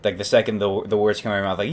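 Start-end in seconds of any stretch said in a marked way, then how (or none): none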